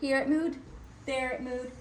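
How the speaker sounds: usual speaking voice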